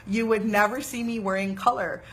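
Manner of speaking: normal talking voice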